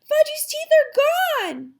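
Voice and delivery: high, very loud cry of horror; girl's scream. A narrator's reading. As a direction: scream it like a horrified little girl. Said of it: "Sheila screamed".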